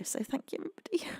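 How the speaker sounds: soft unvoiced whisper; whispered thanks. Real, not acted. Streamer whispers thanks to everybody again, softly. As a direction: hush it out tenderly.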